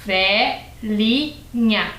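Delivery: "Velhinha" as VE, LI, NHA, very slowly; syllable by syllable. Shown as a demonstration. Teacher repeats a three-syllable word, syllable by syllable. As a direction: split "Velhinha" into three spaced syllables, with plain L. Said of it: "Uma palavra de três sílabas".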